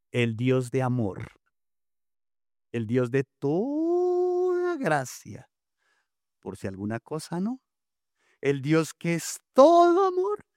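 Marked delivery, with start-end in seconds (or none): 2.7-5.5 exalting. Acted. Emphatic praise of God. 8.4-10.5 exalting. Acted. Emphatic praise of God.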